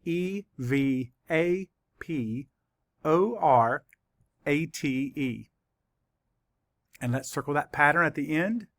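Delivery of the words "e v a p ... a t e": very slow letters in small groups; letter by letter, leaving time to write; spelling for dictation. Shown as a demonstration. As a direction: spell slowly with pauses for writing. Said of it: "I will spell it for you".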